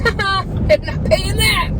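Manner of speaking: hammed-up smug insurer; mocking insurance company. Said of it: "For our medical insurance to be like".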